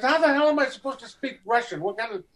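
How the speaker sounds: hammed-up indignation; mock outrage. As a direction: throw it up high in mock disbelief. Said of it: "I said".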